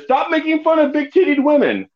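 a high, mock mother voice as a quoted complaint; mother impression. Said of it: "impressions of my mother".

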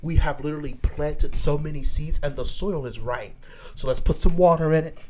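fervent sermon build; rally them like a pulpit preacher; preachy voice. Acted. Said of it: "I don't wanna put on my preachy voice".

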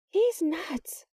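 high-pitched exclamation; astonished cry. A narrator's reading. Sarah watching the intruder escape, high astonished cry. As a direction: cry out in disbelief, pitch leaping up. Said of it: "Sarah cried".

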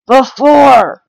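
insist, raising your voice. Real, not acted. Repeats word, louder to insist.

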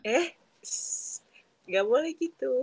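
playful high shush; scolding. Real, not acted. Hushing a friend playfully.

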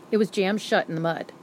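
ordinary delivery, nothing marked

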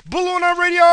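very high, loud show announcement; announcer voice. Acted. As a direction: belt out the show name like a jingle. Show intro jingle call.

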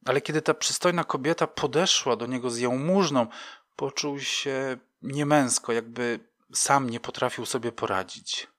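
ordinary delivery, nothing marked

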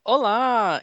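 bright loud hello; excited greeting. Real, not acted. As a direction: greet everyone with a burst of energy. Enthusiastic greeting to the hosts.

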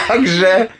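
high squealing laugh; laughing at own joke. Real, not acted. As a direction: burst out laughing at the punchline. (wybuch śmiechu po puencie)

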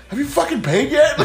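high-pitched interrupting demand, amused underneath; mock exasperation. Bus driver cuts off griping rider, mock exasperated.

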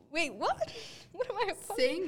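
blurting in disbelief; bewildered. Retold or imitated. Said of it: "I was like".

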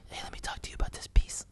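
imitated hushed aside, quick whisper; mimicking a secretive murmur; confidential whisper. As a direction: whisper it fast like pulling someone aside. Imitating person who grabs artist's arm.

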